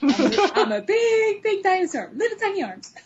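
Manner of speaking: high, laughing T-Rex voice; dinosaur voice. Pretend. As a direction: play a goofy little dinosaur. Acting as a T-Rex.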